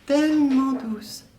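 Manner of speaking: lightly echoing her high sweet tone; a hint of mimicking her dreamy words, slow; mock woman's voice. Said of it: "je vous parle de choses".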